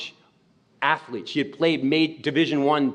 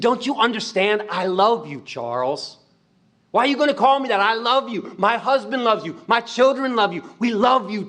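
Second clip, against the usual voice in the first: louder and higher, pleading with love; impassioned plea; 8 semitones higher, 3 dB louder than usual. Retold or imitated. Miss Pat telling the boy her family loves him, impassioned. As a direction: pour it out, begging him to understand.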